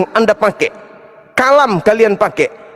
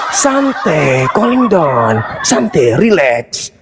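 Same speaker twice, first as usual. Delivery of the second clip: mock soothing; 6 semitones lower, much slower than usual. Calming down an angry opponent.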